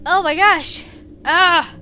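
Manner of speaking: loud startled reaction; startled exclaim. Real, not acted. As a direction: blurt it after sneezing. After sneezing.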